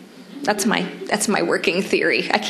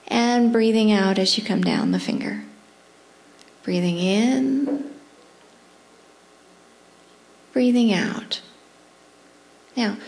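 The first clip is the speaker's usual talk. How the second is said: guided breathing. Acted. Leads a breathing exercise.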